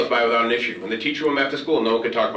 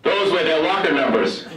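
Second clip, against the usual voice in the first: higher, slow, through laughter; the principal confesses to the teacher, laughing; laughing punchline; 6 semitones higher, 3 dB louder, slower than usual. Retold or imitated. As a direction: deliver the reveal laughing. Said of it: "he says".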